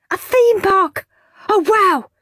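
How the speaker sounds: high, thrilled gasp of joy; excited. Acted. Henry hearing the prize, thrilled. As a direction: burst out with delight.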